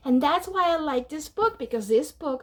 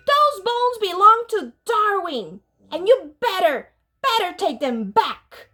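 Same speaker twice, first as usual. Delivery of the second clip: louder, higher scolding mother; stern order; 5 semitones higher, 5 dB louder than usual. A narrator's reading. Mother ordering him to return the bones, stern. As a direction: lay down the law firmly. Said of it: "ordered Mama".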